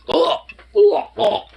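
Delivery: high disgusted grunts; disgusted groans. Real, not acted. Disgust at the dog.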